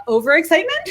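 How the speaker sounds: laughing through the word. Real, not acted. Admitting her overexcitement, laughing. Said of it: "you could sense my".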